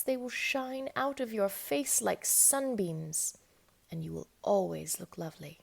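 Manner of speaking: usual speaking voice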